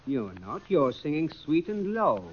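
ordinary delivery

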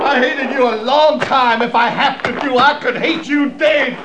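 loud and bitter, spitting it out; hateful shout. Acted. Sniper shouting down at the captain, loud hateful yell. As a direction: shout the hatred down at him. Sniper yells his hatred down.

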